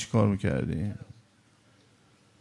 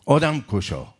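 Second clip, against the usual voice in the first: mock crowd yell, moderately raised; retold protest shout; slower than usual. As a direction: imitate protesters calling out in reproach. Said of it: "فریاد زدن".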